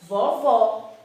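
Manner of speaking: VOVÓ slow, with open "aw" vowels; drawn-out open-O "vovó". Shown as a demonstration. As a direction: model "vovó" slowly with open O sounds. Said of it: "porque o som é aberto".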